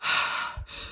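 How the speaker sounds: heavy, drawn-out breaths; heavy male breathing. Retold or imitated. Imitating the hidden man's breathing, heavy and audible. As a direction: breathe like a man hiding close by. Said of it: "we hear the loudest".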